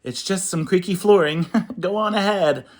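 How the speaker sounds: fast, high fib with a laugh; a mouse covering up, too cheerfully; nervous lie. Acted. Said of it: "Mouse cried and quickly lied".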